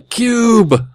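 excited exclamation. Retold or imitated. Reading Kaz's one-word answer.

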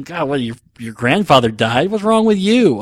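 mock scolding, jumping high and loud at the end; contemptuous disbelief. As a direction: imitate someone belittling a crybaby. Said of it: "that's the implication".

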